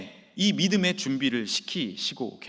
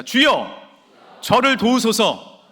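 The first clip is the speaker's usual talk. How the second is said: "저를 도우소서" called out louder and slow, pleading; louder repeat; 6 semitones higher, 9 dB louder, slower than usual. Shown as a demonstration. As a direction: echo the plea to the congregation, slow and loud. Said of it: "따라해 주시겠습니까?". (회중이 따라 외치는 간절한 기도)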